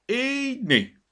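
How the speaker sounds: high, drawn-out exclamation of approval; pleased market woman's lilting cry; satisfied. A narrator's reading. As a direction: show off your handiwork, pleased. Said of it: "biccentett elégedetten".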